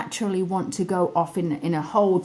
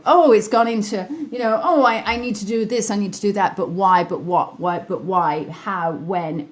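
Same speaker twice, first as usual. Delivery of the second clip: fast, louder, tumbling worried questions; racing anxious brain; 4 dB louder, faster than usual. Retold or imitated. Mimicking her own overthinking brain, fast and fretful. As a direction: rattle them off like an overthinking mind. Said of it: "your brain might go".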